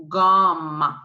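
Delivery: gum said as French gamme, "gahm"; French "gahm" for gum, hard g. Shown as a demonstration. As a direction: start gum with hard g, open "ah". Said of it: "so you pronounce gum".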